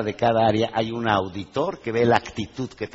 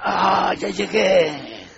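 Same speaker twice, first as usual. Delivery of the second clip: slow, groaned arrival sigh, higher; mock groan; 6 semitones higher, much slower than usual. Worker groaning on reaching the job, slow. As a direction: drag it out with a weary grunt. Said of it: "vuelve a pujar".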